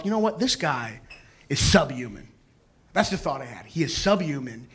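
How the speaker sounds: low, hushed inner monologue; soft, low, solemn private verdict; thinking to himself. Acted. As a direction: quietly pass grave judgement on someone. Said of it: "I'm thinking to myself".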